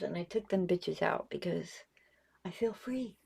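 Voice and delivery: whispered lisp without dentures. Real, not acted. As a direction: confide with a toothless lisp. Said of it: "I'm lisping a bit".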